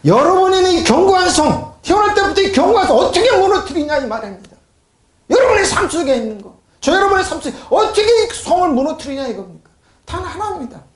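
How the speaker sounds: high-pitched, pressing pulpit cry; full-force preaching. Real, not acted. Preacher challenges congregation about their strongholds, urgent and high. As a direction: drive the question home in a high voice.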